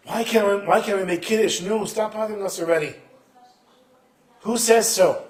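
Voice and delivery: exasperated challenge. Acted. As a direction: demand an answer, loud and high. Acted exasperated challenge to boy.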